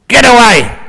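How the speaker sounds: loud, high, furious yell; angry thief shout. Acted. Burglar yells at the abbot to get away, furious shout. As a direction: drive the monk off like a cornered burglar. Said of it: "I can get angry and violent".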